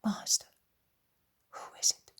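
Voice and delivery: whispered question into an ear; secretive whispered question. A narrator's reading. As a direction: ask it in a hushed whisper. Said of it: "he whispers in his ear".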